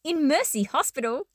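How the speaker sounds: ordinary delivery, nothing marked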